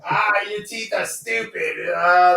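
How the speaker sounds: high, drawn-out bad impression; Donald Trump. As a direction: ham up a terrible celebrity impersonation. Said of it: "could you imagine Donald Trump talking".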